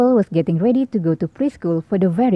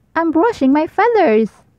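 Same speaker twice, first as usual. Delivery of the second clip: put-on higher, childlike owlet voice; a little owl stalling; Baby Owl refusing his mom, higher and childlike; child owl voice; 8 semitones higher than usual. A narrator's reading.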